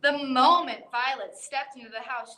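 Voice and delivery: ordinary delivery, nothing marked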